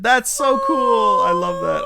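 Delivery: high, loud and slow delight; excited. Real, not acted. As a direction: gush over it. Delighted at forest spirit gift.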